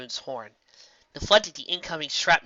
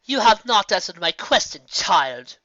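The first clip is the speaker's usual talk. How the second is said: 1 stern demand; 6 semitones higher, 7 dB louder than usual. A narrator's reading. Nightmare Moon demands answer.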